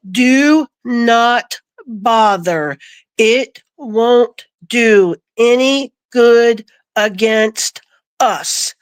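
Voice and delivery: slow, even robotic spirit voice. Retold or imitated. The voice in her head warning her, slow and robotic. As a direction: spell each word out slowly, like a machine. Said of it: "this is how it spoke. Just like this".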